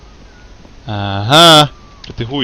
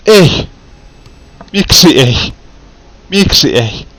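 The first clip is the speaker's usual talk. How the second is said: frustrated shout; 5 semitones higher, 9 dB louder, much slower than usual. Real, not acted. Gamer fails again, yells.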